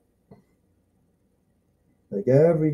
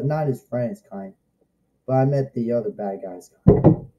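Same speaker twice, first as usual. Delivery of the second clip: usual speaking voice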